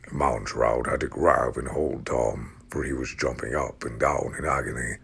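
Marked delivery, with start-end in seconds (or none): none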